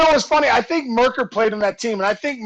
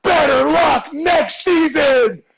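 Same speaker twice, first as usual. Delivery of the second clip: high, slowed-down mock holler; drawn-out taunt in a raised pitch; retold taunting yell; 6 semitones higher, slower than usual. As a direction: heckle the whole bar, stretching the words. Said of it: "I yell at the top of my lungs".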